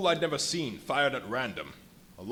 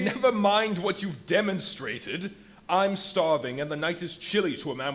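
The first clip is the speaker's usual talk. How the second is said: impatient outburst; 6 semitones higher, 7 dB louder than usual. Acted. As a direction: cut him off and demand food. Impatient man cuts in, demanding.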